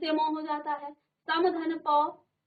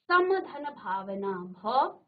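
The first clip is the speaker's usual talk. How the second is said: ordinary talk, nothing marked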